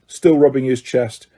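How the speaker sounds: ordinary delivery, nothing marked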